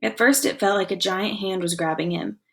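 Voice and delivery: ordinary delivery, nothing marked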